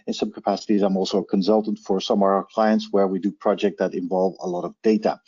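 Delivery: normal talking voice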